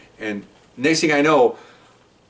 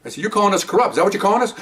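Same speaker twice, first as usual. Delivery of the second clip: higher, indignant retelling; angry officer telling off his superiors, higher and heated; heated retold reproach; 8 semitones higher, faster than usual. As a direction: push back hard on the captains. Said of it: "I am hot".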